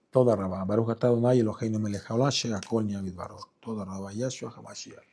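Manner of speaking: quick, soft Hebrew blessing under the breath; murmured prayer. Real, not acted. Preacher thanks God quietly in a quick murmured blessing. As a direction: rattle off a hushed thanksgiving prayer.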